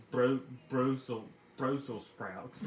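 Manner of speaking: slow, careful Brussels sprouts, stumbling; child struggling with a word. Retold or imitated. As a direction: sound it out like a little kid. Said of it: "really hard time saying Brussels sprouts".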